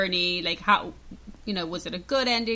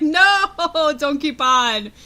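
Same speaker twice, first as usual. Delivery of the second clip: laughing protest; 6 semitones higher, 8 dB louder, much slower than usual. Retold or imitated. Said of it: "That's what I'm like".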